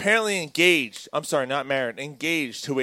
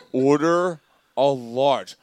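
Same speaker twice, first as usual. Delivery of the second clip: slow repeated line; 3 semitones lower, much slower than usual. Retold or imitated. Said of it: "She goes".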